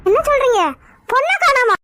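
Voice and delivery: high-pitched, incredulous questioning; shocked. Acted. In-law learns the bride is missing, shocked. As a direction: react in alarm to shocking news.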